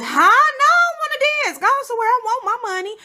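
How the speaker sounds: high yelled no, I want my money; loud high brush-off. Retold or imitated. Teen at the club turning down a dancer, high loud brush-off. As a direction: shout it high and loud, waving him off.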